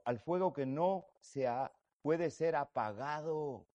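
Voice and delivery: slow, swelling preacher's reading of the verse; fervent sermon reading; scripture reading. Acted. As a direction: proclaim the verse slowly, rising in force. Preacher reads scripture aloud.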